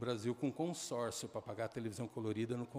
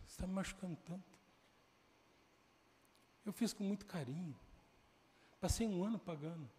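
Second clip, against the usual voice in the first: higher, softened, wounded protest; hurt plea; 7 semitones higher, 4 dB quieter than usual. Retold or imitated. Son whose gift to mother was refused, hurt soft plea. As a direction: plead softly with a raised pitch.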